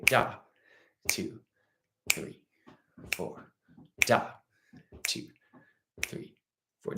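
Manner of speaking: Da stressed, then a soft two, three, four; accent on beat one. Shown as a demonstration. Teacher leading students, accenting Da on beat one. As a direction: hit Da and let the rest fall away. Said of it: "Say that first one with me".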